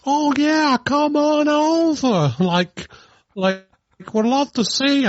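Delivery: high, slow put-on kid voice; a silly unconvincing fake voice; mock Fester voice. Podcast host mimicking Fester luring Emily, high put-on voice. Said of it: "and goes".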